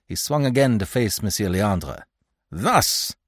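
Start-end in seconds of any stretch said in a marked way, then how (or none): 2.5-3.2 scornful, higher-pitched command; curt disdain; contemptuous. A narrator's reading. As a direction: throw the word at him with contempt. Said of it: "he bade him contemptuously".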